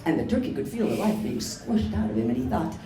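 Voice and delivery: usual speaking voice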